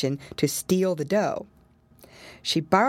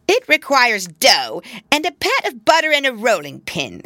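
louder, higher fussy rat wife; a practical old rat housewife; old woman rat voice; 7 semitones higher, 7 dB louder than usual. Acted. Anna Maria answers.

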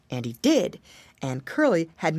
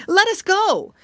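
pleading monkey; 13 semitones higher, 6 dB louder than usual. A narrator's reading. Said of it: "asked the monkey".

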